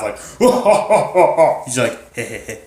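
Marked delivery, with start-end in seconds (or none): 0.2-1.6 loud, high booming "ho ho ho" laugh; big hammed-up villain laughter; imposing villain laugh. Shown as a demonstration. Showing the laugh Facade doesn't have, imposing laugh. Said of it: "an imposing laugh". 2.1-2.6 soft, unimposing "heh heh heh"; small chuckle. Shown as a demonstration. Facade amused at heroes arguing, small private chuckle. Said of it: "That's his laugh".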